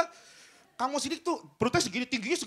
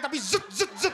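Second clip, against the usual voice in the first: buzzing motor noise, strained zet zet; strained engine noise. Shown as a demonstration. Comic strains like a scooter under a heavy rider. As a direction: mimic a sputtering overloaded scooter. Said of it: "suara motor udah bukan ngeng".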